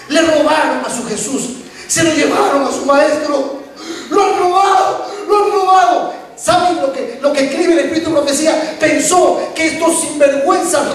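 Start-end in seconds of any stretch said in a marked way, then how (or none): 4.1-6.1 they've stolen him, high and frantic; panicked cry. Acted. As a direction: voice Mary's panic at the open tomb. Mary's panic voiced in sermon.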